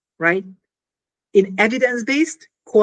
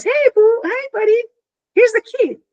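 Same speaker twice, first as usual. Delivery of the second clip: much higher, louder bright greeting; cheery friend voice; 14 semitones higher, 4 dB louder than usual. Pretend. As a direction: play the chirpy friend handing over the key. Friend voiced bringing key.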